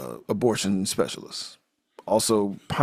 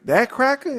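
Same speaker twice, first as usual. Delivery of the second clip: incredulous; 14 semitones higher, 3 dB louder, slower than usual. Retold or imitated. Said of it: "she's like".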